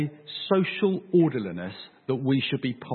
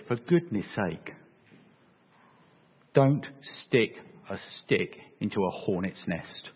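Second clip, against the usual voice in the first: slow, low, word-by-word warning; measured, weighty plea in a lowered voice; deliberate warning; 7 semitones lower, 5 dB quieter than usual. Acted. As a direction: spell it out slowly and quietly, pausing between words. Paul's plea voiced in sermon.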